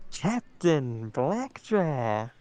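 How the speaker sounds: put-on drawn-out old-timey ring announcer; slow, stretched ring introduction; 1950s announcer. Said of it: "Can you do a nineteen fifties announcer?".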